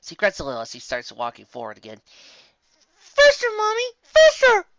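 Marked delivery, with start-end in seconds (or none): 3.2-4.7 put-on high, loud excited kid shout; child's yell. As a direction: urge her on with a piercing child's shout. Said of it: "I yell through the tail".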